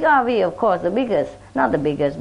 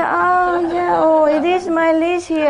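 fake surprise; 5 semitones higher, slower than usual. Neighbor pretending to find leash.

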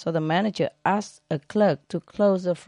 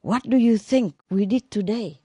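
put-on excited boy; 5 semitones higher than usual. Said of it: "tell his mother".

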